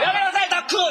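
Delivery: frantic dad scolding; man scolding child. Retold or imitated. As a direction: yell at a kid in a store. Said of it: "ってわーなってる".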